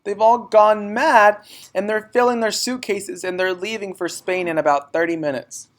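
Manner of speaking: put-on high, loud panicked girl; girl telling her teacher the news; upset child. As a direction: pour it out in distress. Upset child after running.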